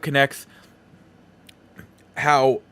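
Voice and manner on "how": ordinary talk, nothing marked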